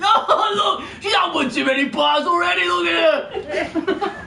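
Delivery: high, loud jeering shout through laughter; mocking pig merchant. Pig merchant mocks the fallen mother to the crowd, jeering shout. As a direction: bellow the insult across the market. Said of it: "He looks around and goes".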